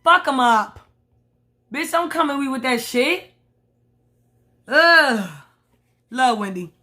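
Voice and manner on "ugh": loud exasperated groan of disgust; disgusted ugh. Real, not acted. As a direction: let out a loud fed-up ugh. Disgusted reaction to reality show.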